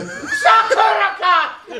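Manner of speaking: high, drawn-out mock yell; yelling from roof. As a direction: holler it down from a rooftop. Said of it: "I was yelling something from the roof".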